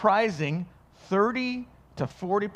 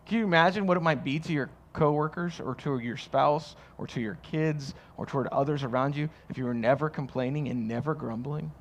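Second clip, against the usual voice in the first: ordinary delivery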